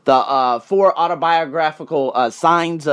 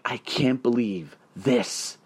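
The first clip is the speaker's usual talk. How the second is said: low, soft, slow retold amazement; awestruck; 5 semitones lower, 7 dB quieter, much slower than usual. Newly arrived traveler answering a friend, hushed awe. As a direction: let each word sink in, quietly stunned. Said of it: "I was in unbelievable awe".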